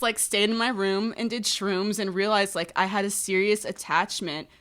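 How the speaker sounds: ordinary delivery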